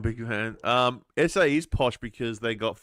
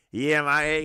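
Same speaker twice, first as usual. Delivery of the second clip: higher mock ocker Aussie drawl; ocker Australian accent; 4 semitones higher, 4 dB louder than usual. Imitating Western Australians for his mates, broad ocker accent. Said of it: "they're like".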